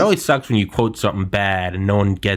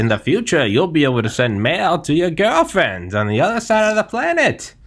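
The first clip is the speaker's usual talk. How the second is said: put-on old-timey voice; 7 semitones higher than usual. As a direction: sell it like a wartime newsreel. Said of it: "Old-timey voice".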